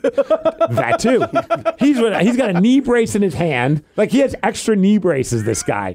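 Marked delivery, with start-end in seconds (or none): 0.0-3.2 breaks into a high laugh; amused giggle. Real, not acted. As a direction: laugh it out, slowly. Laughing at the EMT question.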